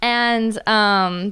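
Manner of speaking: ordinary talk, nothing marked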